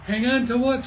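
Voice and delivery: storybook scream, rising in pitch; screamed question. A narrator's reading. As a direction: shout the question back over wind. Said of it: "screamed Pete".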